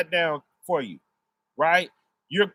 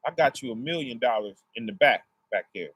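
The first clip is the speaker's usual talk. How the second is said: lower, softer and generous; the Lord rewarding; 8 semitones lower, 4 dB quieter than usual. Retold or imitated. The Lord thanking the son who brought the remote, low and soft. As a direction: drop low to hand out the reward. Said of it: "he gonna say".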